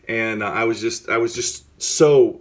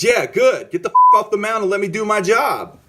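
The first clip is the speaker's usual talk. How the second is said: high, loud retold yell; acted-out furious blow-up, pitched way up; angry outburst; 11 semitones higher, 5 dB louder than usual. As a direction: shout the manager off your turf. Retold blow-up at his manager.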